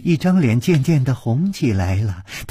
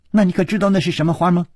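rapid question; 8 semitones higher, much faster than usual. Acted. Girl asks quickly.

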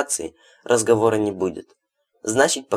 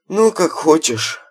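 mock sadness; 8 semitones higher, 5 dB louder, slower than usual. A narrator's reading. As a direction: fake sorrowful sigh. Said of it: "с наигранной грустью произнёс Хладов".

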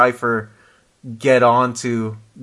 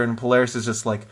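usual speaking voice

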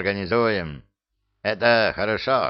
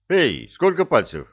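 higher, louder, insistent call; louder insistent repeat; 8 semitones higher, 4 dB louder than usual. Acted. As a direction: raise the voice to get through. Repeats question to unresponsive werewolf.

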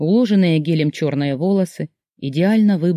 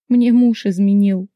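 higher, louder and slow, choked with tears; tearful confession; 4 semitones higher, 5 dB louder than usual. A narrator's reading. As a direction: spill it out through tears. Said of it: "прошептала, заливаясь обжигающими слезами".

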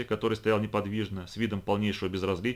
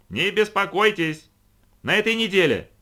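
shouting; 10 semitones higher, 6 dB louder than usual. A narrator's reading. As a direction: holler reassurance over the din. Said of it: "оба они орали во всё горло".

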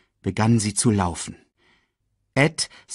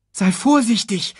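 high, anxious caution; worried young voice; urgent warning; 11 semitones higher, 4 dB louder than usual. A narrator's reading. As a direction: warn your friend, pitched up. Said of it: "warnte Simba Nala".

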